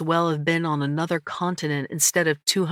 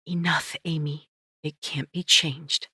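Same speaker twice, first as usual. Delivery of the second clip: whisper; 3 dB quieter than usual. A narrator's reading. Remembered voice of dead husband.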